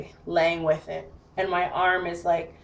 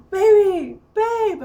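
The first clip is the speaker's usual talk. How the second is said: high, louder urgent call; retold calling out; 12 semitones higher, 6 dB louder, slower than usual. Banging on window for partner, retold high yelling. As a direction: imitate hollering to wake a partner, pitched way up. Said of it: "I'm banging on it".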